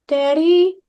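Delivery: put-on high, loud little girl calling Daddy; creepy little kid voice. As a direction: call out like an eerie small child. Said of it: "her creepy little kid voice".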